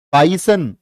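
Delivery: normal talking voice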